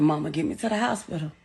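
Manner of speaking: soft, low, quick retold plea; unpanicked plea. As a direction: quote him quietly, with no panic at all. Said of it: "He never panicked".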